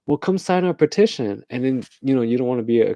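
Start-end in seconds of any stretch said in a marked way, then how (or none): 0.1-1.4 high, coaxing canvasser voice; mock petition canvasser. Petitioner luring a whale lover to sign, mock coaxing. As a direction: coax them over in a higher pitch.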